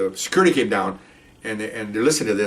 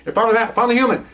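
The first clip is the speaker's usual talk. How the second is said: excited dog; 10 semitones higher than usual. Pretend.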